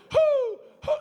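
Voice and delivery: high loud owl hoots. Retold or imitated. Dad hooting with the girls on the dock, high hoots. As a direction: hoot out over the lake.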